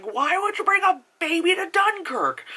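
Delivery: high, incredulous mock outrage; exasperated inner voice. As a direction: squeak it out in disbelief. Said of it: "'cause I'm like".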